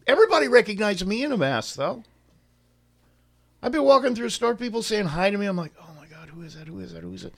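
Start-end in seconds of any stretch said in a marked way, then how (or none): 5.8-7.3 very soft, hurried aside to himself; hushed panic. Retold or imitated. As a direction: mutter it under your breath, puzzled. Muttered private panic at unrecognized greeters.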